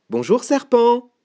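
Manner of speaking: high mouse hail; calling out greeting. Acted. Mouse hailing the snake in the wood, high call. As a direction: sing out a high hello.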